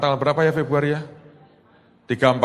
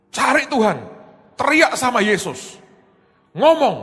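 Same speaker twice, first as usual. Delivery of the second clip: high, pleading call to seek God; urgent urging; 11 semitones higher than usual. Retold or imitated. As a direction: urge him to cry out to Jesus. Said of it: "Saya bilang". (mendesak dengan nada tinggi)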